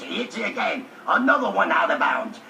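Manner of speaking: usual speaking voice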